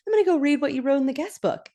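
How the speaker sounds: high, quick, eager hostess voice; imitating an eager host; LaDonna. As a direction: rattle it off in a put-on high voice. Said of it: "And LaDonna goes".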